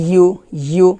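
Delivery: ordinary talk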